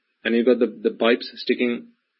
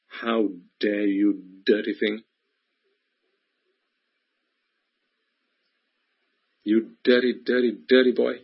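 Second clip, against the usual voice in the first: scolding inner voice; 2 semitones lower, 3 dB quieter than usual. Acted. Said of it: "there's a voice in the back of your mind".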